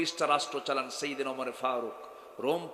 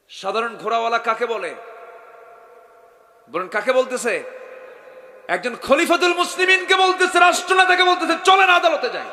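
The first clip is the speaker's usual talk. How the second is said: rising to a full shout, much higher; building shout; 8 semitones higher, 13 dB louder than usual. Real, not acted. Preacher shouting what the horse seller dared say. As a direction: build to a fiery peak.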